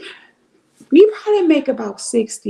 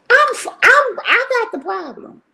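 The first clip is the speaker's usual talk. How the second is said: high, strained admission; exasperated confession; 12 semitones higher than usual. Retold or imitated. Host admitting her YouTube habit, high and exasperated. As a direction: throw up your hands and admit it. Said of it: "and I'm like".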